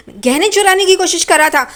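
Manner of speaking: mock outrage at a thief; indignant accusation. Saili vows to punish the thief, acted indignation.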